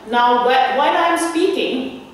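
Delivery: normal talking voice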